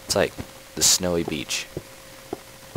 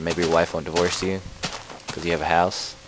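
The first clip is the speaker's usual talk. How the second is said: ordinary talk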